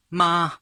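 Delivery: ma held high and level; first tone ma. Shown as a demonstration. Teacher demonstrating Mandarin first tone on ma. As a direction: say ma high and flat. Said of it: "high and flat tone".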